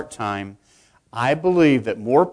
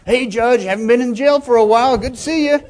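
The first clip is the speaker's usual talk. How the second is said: much higher, bright friendly call; high, chummy greeting to the judge; cheery meat-market guy; 10 semitones higher, 4 dB louder than usual. Retold or imitated. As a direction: hail the judge like an old pal.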